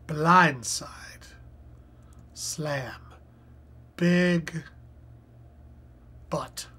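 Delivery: whispered clue word; whispering. Real, not acted. Reading clue to self.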